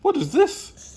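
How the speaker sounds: high, loud, curious; high-pitched nosy question; excited question. Acted. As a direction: blurt it out in a high voice. Bean plays with headlamp.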